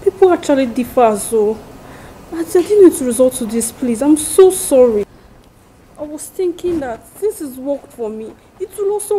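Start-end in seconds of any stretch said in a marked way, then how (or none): 0.0-5.0 loud, desperate begging; pleading apology. Acted. Friend loudly begging forgiveness after bad advice. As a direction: plead hard for forgiveness, voice raised.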